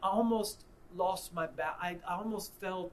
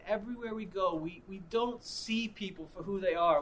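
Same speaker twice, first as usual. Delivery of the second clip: usual speaking voice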